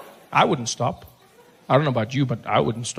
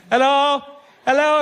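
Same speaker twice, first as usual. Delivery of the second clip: calling out; 7 semitones higher, 3 dB louder than usual. Retold or imitated. Calling out car window.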